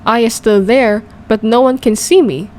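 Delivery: ordinary delivery, nothing marked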